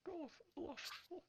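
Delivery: high voice calling out, fading to distant soft calls; far-off call trailing into quiet; yelling worker. Acted. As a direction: pass the call along the room, further and further off. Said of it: "somebody else yells".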